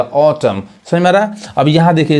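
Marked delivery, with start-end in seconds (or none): 0.0-0.6 ऑटम with silent N; correct pronunciation. Shown as a demonstration. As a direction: end ऑटम on the M. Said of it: "यहाँ तक पढ़ाएगा". (ऑटम, एन को खामोश रखकर)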